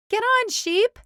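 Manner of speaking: high loud shout. A narrator's reading. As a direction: call her aboard. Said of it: "everyone shouted".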